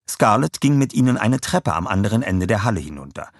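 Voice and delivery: ordinary delivery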